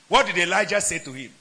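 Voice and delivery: normal talking voice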